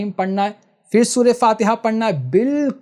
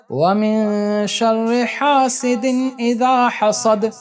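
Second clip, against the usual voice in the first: Quran recitation; 4 semitones higher, much slower than usual. Shown as a demonstration. Teacher reciting a surah for students to hear, chanted slowly. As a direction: sing out each verse with long held vowels. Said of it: "اس کو آپ سن لیجئے".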